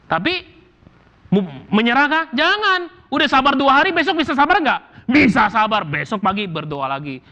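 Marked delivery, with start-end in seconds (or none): 0.1-5.9 rousing exhortation. Real, not acted. Preacher exhorting congregation.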